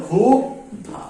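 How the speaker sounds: ক্ষুধা as khu, pause, dha; syllable-by-syllable pronunciation. Shown as a demonstration. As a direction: split ক্ষুধা slowly, starting on plain kh. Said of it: "অর্থাৎ এর উচ্চারণ".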